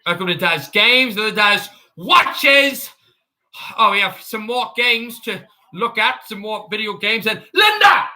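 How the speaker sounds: Daz Games. Acted. Streamer joking as a YouTuber calling Linda upstairs, hammed-up impersonation. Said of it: "that's my impersonation of him".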